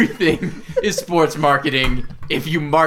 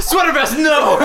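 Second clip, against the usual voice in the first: shouting; 11 semitones higher than usual. Acted. Calling out in alarm.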